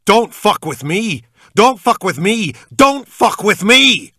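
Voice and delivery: high, very loud, slow repeated scream; screaming out of control. A narrator's reading. Captor on top of him screaming, out of control. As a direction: scream it, building each time, losing control. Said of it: "he was screaming".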